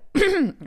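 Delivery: clearing a hoarse throat; quick cough to clear the voice. Real, not acted. Clears hoarse throat mid-sentence.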